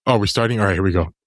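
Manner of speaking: normal talking voice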